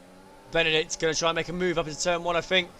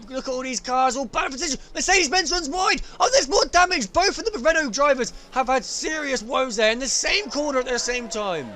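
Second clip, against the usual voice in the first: much higher, louder crash call; excited play-by-play; 9 semitones higher, 5 dB louder than usual. Acted. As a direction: shout the pileup live. Live race play-by-play.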